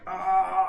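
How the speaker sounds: acted-out pained moan; mock cry of pain. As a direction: moan as if something twists inside. Acting out the twisting pain.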